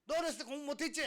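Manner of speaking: high, loud accusatory question; acted teacher's scolding shout; accusing teacher. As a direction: confront the student loudly. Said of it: "선생님들이".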